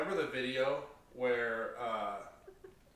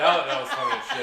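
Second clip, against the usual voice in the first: laughing; 9 dB louder than usual. Real, not acted. Laughing at a funny story.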